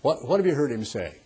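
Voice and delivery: normal talking voice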